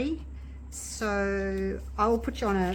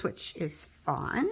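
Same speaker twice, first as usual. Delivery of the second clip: ordinary talk, nothing marked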